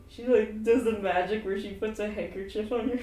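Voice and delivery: ordinary delivery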